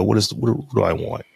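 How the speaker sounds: normal talking voice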